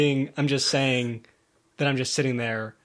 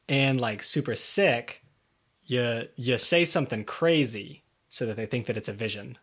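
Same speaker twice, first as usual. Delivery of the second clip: ordinary delivery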